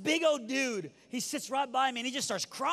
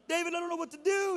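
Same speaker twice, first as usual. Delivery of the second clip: high, whiny imitated sobbing voice; crying; 7 semitones higher, faster than usual. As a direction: imitate a big guy blubbering helplessly. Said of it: "He's just crying".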